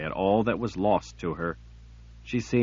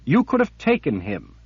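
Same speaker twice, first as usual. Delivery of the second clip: urgent, insistent higher voice; pressing woman's voice; 5 semitones higher, 5 dB louder than usual. Acted. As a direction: push the point hard. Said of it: "she pressed".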